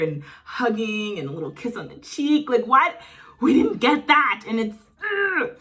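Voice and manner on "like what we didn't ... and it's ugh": high, loud frustrated outburst; exasperated. Real, not acted. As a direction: vent your frustration at the show. Complaining about missing moments.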